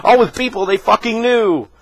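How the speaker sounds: high-pitched mock crying complaint; mocking whine. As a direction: imitate a whiner, starting very high and easing down. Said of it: "cry and bitch and scream and moan".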